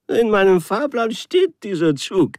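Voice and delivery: high, loud wailing complaint; stranded traveller insisting to the official, wailing; whining lament. Acted. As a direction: plead it like a wronged child. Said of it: "jammerte ich weiter".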